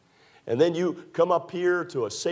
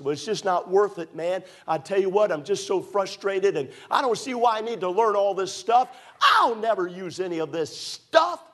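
higher, louder frustrated complaining; frustrated student; 7 semitones higher, 3 dB louder than usual. Retold or imitated. Student complaining about schoolwork, mock frustrated shout. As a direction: vent like a fed-up student.

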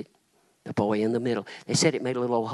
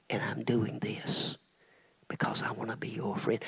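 whisper; 7 dB quieter than usual. Acted. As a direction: breathe it close and tender. Jesus whispering in vision.

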